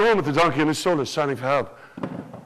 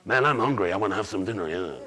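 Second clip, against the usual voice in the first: low, softer hungry dogs fading out; deep dog voice; 12 semitones lower, 5 dB quieter than usual. Acted. City dogs eyeing the turkey in a low voice. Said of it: "do you know what they said".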